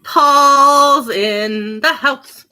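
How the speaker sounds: slow, loud drawn-out announcement; announcer. Pretend. Owner announcing her dog's entrance, slow loud hype. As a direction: announce him like a star arriving.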